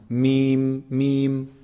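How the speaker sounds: "Mim" held long with a hum through the nose; nasal mim. Shown as a demonstration. Teacher demonstrating the lips closing, nasal drawn-out "Mim". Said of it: "some nasal sound also coming".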